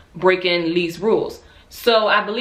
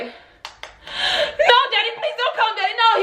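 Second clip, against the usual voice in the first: pitched way up, begging with a laugh; high pleading; slower than usual. Retold or imitated. Daughter begging father not to come, high pitched plea. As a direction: plead with daddy, high and frantic. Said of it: "I was like".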